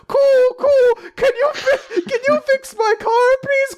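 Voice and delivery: high, timid pleading; meek squeaky voice. Retold or imitated. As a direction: squeak it out like a pushover. Meek plea to the mechanics.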